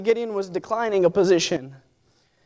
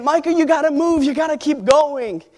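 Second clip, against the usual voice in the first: higher, pushing call from the sideline; coach urging; 5 semitones higher than usual. Retold or imitated. Coach calling to his fighter to keep moving. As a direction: urge him on from a distance. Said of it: "my coach was over there saying".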